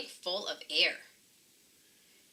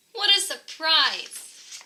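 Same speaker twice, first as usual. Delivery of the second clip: delighted surprise; 7 semitones higher, 4 dB louder, slower than usual. Acted. Pete delighted by seahorse.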